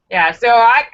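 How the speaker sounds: ordinary delivery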